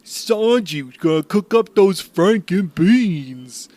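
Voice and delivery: high, slow cartoon voice; silly character voice. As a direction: ham it up, goofy and slow. Goofy voiced bit.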